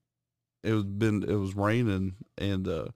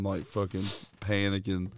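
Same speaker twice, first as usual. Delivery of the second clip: normal talking voice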